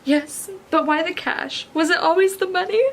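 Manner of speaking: narrated anguish; tortured questioning. A narrator's reading. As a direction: ask as if wounded, voice climbing. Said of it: "His tortured, tortured voice is barely audible".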